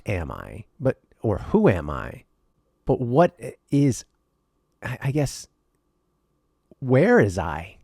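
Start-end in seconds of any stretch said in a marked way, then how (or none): none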